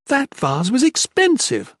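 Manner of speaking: high, slow complaining whine. Acted. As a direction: complain about the loss. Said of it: "Murta complained".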